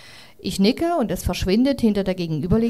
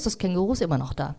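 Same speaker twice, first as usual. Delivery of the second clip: usual speaking voice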